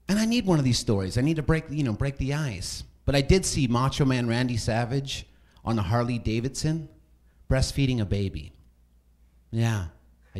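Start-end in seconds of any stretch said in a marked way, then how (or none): none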